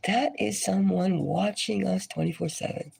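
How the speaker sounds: ordinary talk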